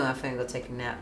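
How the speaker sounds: low, soft sleepy reply; sleepy mumble. Retold or imitated. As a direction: mumble it half asleep.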